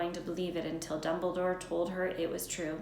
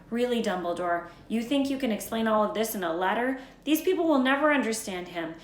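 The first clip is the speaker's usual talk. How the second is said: higher and louder, worked up; much louder indignant insistence; heated disbelief; 7 semitones higher, 9 dB louder than usual. A narrator's reading. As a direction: argue it out, growing heated. McGonagall argues against letter.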